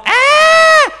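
long, high, loud shriek; imitated child's scream. Preacher mimicking daughter screaming as she is strapped in, piercing scream. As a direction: screech like a protesting toddler. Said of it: "She would scream".

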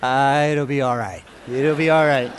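a hint of a mumbled brush-off; lightly retold sleepy dodge; lazy excuse. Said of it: "What we say when we don't want".

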